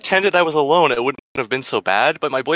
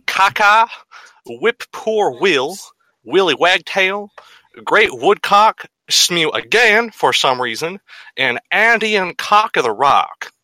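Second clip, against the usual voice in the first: hammy mock Southern drawl; southern accent; 5 semitones higher than usual. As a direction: put on a bad Southern accent. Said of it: "in, like, a shitty southern accent".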